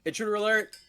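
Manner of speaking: high, loud ship alarm call; alarm shout. Pretend. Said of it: "that's my raid intro".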